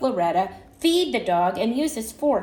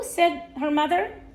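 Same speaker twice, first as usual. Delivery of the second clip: plain reading voice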